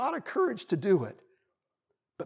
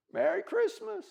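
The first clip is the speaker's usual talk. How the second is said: high, small, much softer voice; subdued bunny reply; 12 semitones higher, 5 dB quieter than usual. A narrator's reading. Rejected bunny says goodbye to squirrel, subdued. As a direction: return the greeting, let down.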